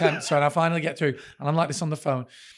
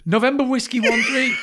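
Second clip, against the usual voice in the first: higher, insistent spelling-out; retold exasperation; 6 semitones higher, 4 dB louder than usual. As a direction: act out losing patience with the operator. Repeats postcode to confused operator.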